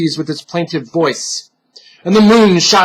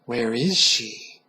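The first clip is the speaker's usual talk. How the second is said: soft, low, slow eerie voice; soft, slow unseen stalker; light creature voice; 5 semitones lower, 9 dB quieter, much slower than usual. Acted. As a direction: breathe it from the shadows. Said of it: "the light voice returned".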